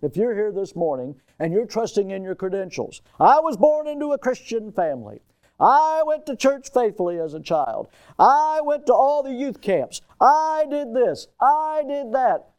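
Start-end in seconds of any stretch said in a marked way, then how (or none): 3.2-12.5 high, stretched "I" opening each claim; mock boasting. Preacher imitating churchgoers trusting in their credentials, mock boasting. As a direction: parade each merit in a raised, self-important voice.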